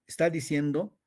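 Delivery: ordinary talk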